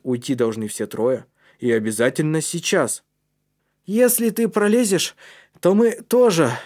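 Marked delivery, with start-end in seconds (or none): 3.9-6.5 put-on high, loud, firm boy's voice; promising. A narrator's reading. Калиб promises they will follow Матиас, firm. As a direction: promise it to the one ahead. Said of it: "пообещал Калиб".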